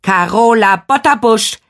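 curt. A narrator's reading. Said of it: "kurz und knapp".

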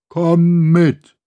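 loud, high hollow alien command; cold, empty order from a stranger. Acted. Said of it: "eine hohl klingende Stimme".

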